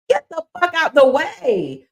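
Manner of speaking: loud, slow, high-pitched order; loud demand. Real, not acted. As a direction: tell them off at full voice. Angry demand to old officials.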